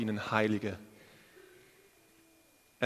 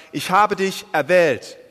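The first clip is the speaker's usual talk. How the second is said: higher, louder and slow, pressing on habe; God's voice; 4 semitones higher, 6 dB louder, slower than usual. Acted. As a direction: proclaim it as God's own words. Preacher voices God's promise. (Gottes Stimme, langsam und laut verkündet)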